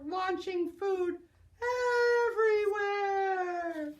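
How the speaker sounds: slow, rising dramatic wail; dramatic cry. Acted. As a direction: stretch it out with drama. Food flies dramatically.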